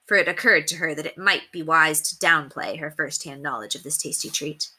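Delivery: plain reading voice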